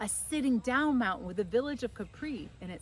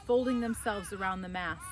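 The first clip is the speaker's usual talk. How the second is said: ordinary delivery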